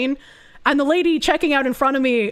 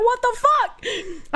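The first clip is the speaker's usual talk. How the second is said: baffled; 10 semitones higher, much slower than usual. Retold or imitated. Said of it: "I was like".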